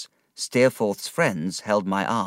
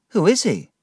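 higher, louder, puzzled demand; startled question; 9 semitones higher, 4 dB louder than usual. A narrator's reading. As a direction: ask sharply in surprise. David demands to know.

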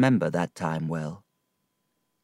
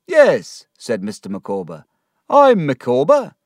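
pompous reply; 5 semitones higher, 8 dB louder than usual. Acted. Micawber answers the bailiff proudly.

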